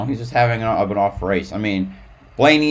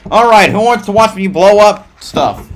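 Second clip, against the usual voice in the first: high, loud hype call; rallying shout; 11 semitones higher, 9 dB louder than usual. Real, not acted. Streamer calling viewers to watch some gameplay, high rallying shout.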